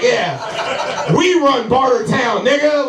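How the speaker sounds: high-pitched, hammed-up drunk girlfriend mouthing off. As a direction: egg on the fight like a drunk girlfriend. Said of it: "I was acting like a drunk girlfriend at a party".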